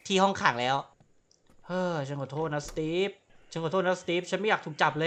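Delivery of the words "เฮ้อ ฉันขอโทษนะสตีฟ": softer, sighing apology; mock sorry sigh. Gamer fake-apologizes before attacking cop, mild sigh.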